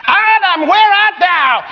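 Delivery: high, drawn-out searching call; God calling out. Acted. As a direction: call out for someone hiding. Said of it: "God is pretending he doesn't know".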